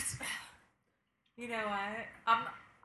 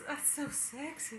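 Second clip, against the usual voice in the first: sexy voice; 15 dB quieter, slower than usual. Acted. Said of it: "my sexy voice".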